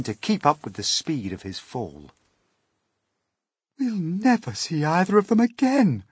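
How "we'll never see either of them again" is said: high, loud wail of despair; hammed-up high lament; distressed cry. A narrator's reading. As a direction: wail it as if all is lost. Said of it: "cried the ladybird".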